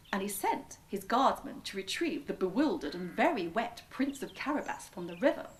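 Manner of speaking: usual speaking voice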